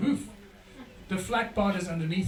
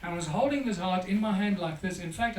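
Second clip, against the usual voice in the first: normal talking voice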